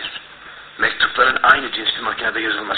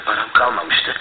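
ordinary talk, nothing marked

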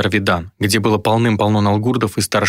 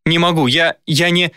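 high, helpless stammer; pained high-pitched helplessness; distressed faltering; 8 semitones higher than usual. A narrator's reading. Dayna falters beside dying mother.

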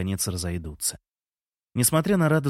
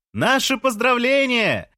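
very high, drawn-out holler; calling across balconies; 12 semitones higher, 4 dB louder, slower than usual. A narrator's reading. Neighbours answering from another balcony, high drawn-out call. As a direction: sing it out slowly to the neighbours, far up in pitch. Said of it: "ответили жильцы с другого балкона".

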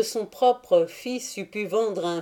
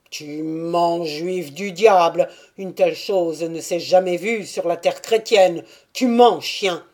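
put-on angry old Cossack; 6 dB louder than usual. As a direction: throw the accusation at him, louder. (vieux cosaque furieux qui accuse)

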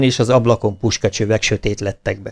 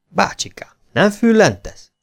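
teasing doubt; 9 semitones higher than usual. A narrator's reading. Soldier doubting the bear.

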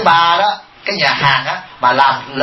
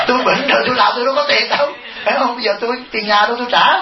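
a bit higher, quick, lightly voiced complaining pensioner; mock grumbling old lady; 6 semitones higher, faster than usual. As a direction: mimic her whining about the rent, half in jest. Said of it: "Chửi cho coi, chửi ai?".

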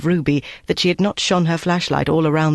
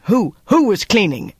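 higher, urgent demand; sharp interrogation; 5 semitones higher than usual. A narrator's reading. Officer cutting in on the cook, sharp demand. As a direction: cut in with an insistent question.

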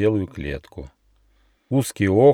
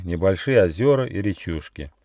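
ordinary delivery

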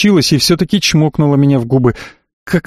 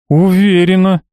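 whiny spoiled pouty drawl; 5 semitones higher than usual. A narrator's reading. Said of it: "закапризничала девушка".

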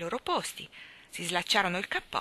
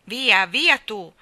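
loud, slow, higher dismissal; commanding shout; 4 semitones higher, 10 dB louder, much slower than usual. A narrator's reading. The sun drives the clown away, shouting. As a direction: send them off with a drawn-out shout. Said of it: "gridò il sole".

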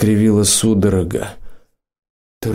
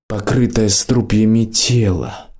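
ordinary delivery, nothing marked

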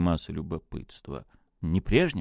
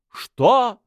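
high, loud burst of surprise; astonished exclamation; 9 dB louder than usual. A narrator's reading. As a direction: cry out in amazement. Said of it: "воскликнули все, удивляясь". (изумлённый возглас)

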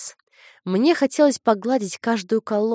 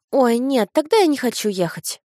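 выше и громче, детский протест; упрямая девочка; капризный ребёнок; 6 semitones higher, 4 dB louder than usual. A narrator's reading. Дочь отказывается ехать. (капризный детский голос)

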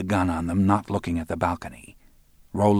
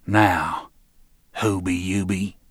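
Roland's deliberate echo; slower than usual. Acted. Roland throws the guys' joke back, calm measured menace. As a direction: hand the joke back evenly, unhurried. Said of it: "He said".

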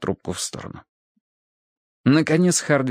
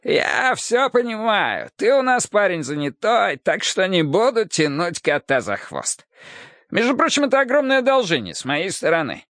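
croaking carnival barker; 7 semitones higher than usual. Acted. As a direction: hawk it like a sick fairground barker. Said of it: "закаркал голосом ярмарочного зазывалы, страдающего раком глотки". (каркающий голос ярмарочного зазывалы)

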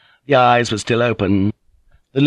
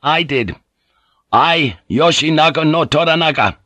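higher, proud declaration; defiant; 7 semitones higher than usual. Acted. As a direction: declare your name and rank in defiance. Naga defiantly owns the order.

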